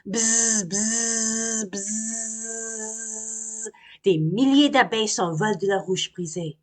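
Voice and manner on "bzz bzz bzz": higher buzzing, fading out; imitated buzzing; buzzing bees. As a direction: swarm rising from the hive. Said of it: "de plus en plus fort".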